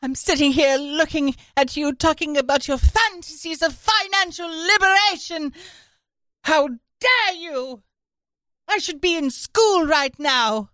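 high, loud mock outrage; imitating Greta Thunberg. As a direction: rant like an outraged teen activist. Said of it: "Greta Thunberg saying".

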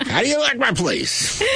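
high, laughing mock owner voice; Nathan Famous character. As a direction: ham up the hot dog guy. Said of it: "Eddie once said that he was Nathan Famous".

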